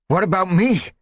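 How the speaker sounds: high, loud demand. Acted. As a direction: demand his turn. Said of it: "One Whisker demanded".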